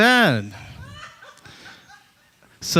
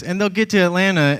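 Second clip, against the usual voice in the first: ordinary talk, nothing marked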